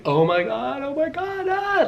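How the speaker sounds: high, rising mock wail of panic; imitating wailing. Mimicking the panicking passenger behind her, a high mock wail. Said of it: "Crying, wailing".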